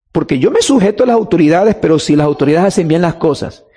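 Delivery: normal talking voice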